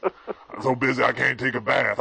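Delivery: ordinary talk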